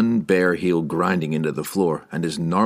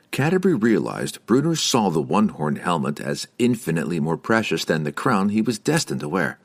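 ordinary delivery

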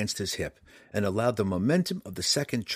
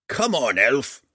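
scolding dwarf, higher and louder; gruff gravelly dwarf voice; 5 semitones higher, 7 dB louder, slower than usual. A narrator's reading. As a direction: scold him like an impatient dwarf. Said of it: "scolded the dwarf".

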